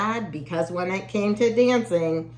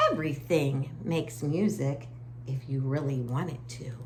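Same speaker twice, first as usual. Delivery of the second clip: plain reading voice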